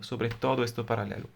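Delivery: ordinary talk, nothing marked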